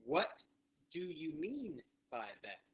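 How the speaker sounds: slow, lifted "What", then a pause; deliberate spaced-out question; repeat-after-me model. Shown as a demonstration. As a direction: lead learners through it word by word. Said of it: "so say that with me".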